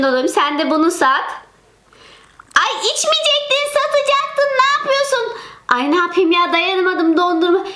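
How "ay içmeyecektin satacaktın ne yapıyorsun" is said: put-on child voice. Çocuk sesiyle sitem.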